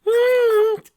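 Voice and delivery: put-on tiny high defiant child voice; stubborn filly. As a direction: talk back like a stubborn kid. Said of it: "Dinky argued again".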